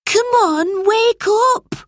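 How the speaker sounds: urgent rousing. A narrator's reading. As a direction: rouse a sleeper, loud and high. Boy shaking his sleeping brother.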